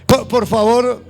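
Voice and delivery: loud, fervent preaching; rousing sermon delivery. Acted. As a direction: preach it out to the congregation. Preaching to congregation at full force.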